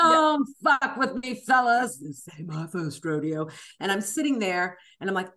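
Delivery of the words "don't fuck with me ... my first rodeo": a hint of a tough movie diva; Joan Crawford. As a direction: play it like a hard old film star. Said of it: "I felt a little bit like Joan Crawford".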